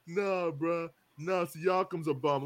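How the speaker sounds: normal talking voice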